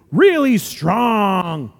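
drawn-out, forceful "really strong"; emphatically strong. Shown as a demonstration. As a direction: put full conviction on "really strong". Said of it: "your faith may sometimes be".

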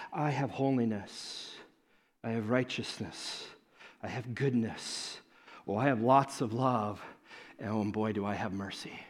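soft, tender declaration. Acted. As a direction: say it gently, like a promise. God listing tender attributes.

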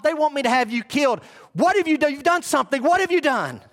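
high-pitched exasperated interrogation; worked-up, incredulous ruler; agitated Pilate. Acted. Pilate's agitated retort to Jesus.

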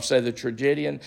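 ordinary talk, nothing marked

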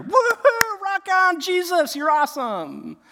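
very high, loud whooping celebration; mock cheering. Disciples reunited with Jesus, acted cheering. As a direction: whoop and holler like a reunited gang. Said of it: "I just picture the gang being back together".

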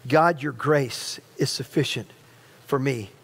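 hushed proclamation. Shown as a demonstration. Pastor modelling the prayer for the church, hushed. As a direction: say it gently as a prayer. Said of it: "what I want you to proclaim".

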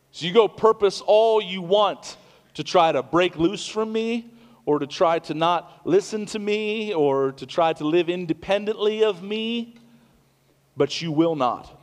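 loud, high fiery warning; preaching at full force. Acted. Pastor warning listeners they cannot break loose, fiery preaching. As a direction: drive the warning home.

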